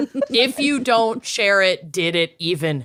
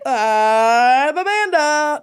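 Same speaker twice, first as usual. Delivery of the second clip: slow, higher sing-song name; slow drawn-out intro; 5 semitones higher, 4 dB louder, much slower than usual. Acted. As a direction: stretch the name out slowly.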